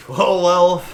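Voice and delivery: loud, smug "well, well"; a boardroom suit gloating out loud; smug executive. Retold or imitated. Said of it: "they're just like".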